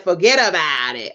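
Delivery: mock Italian accent, stretched out; bad Italian accent. Host asking who said the catchphrase, mock Italian accent. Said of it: "Italian accent".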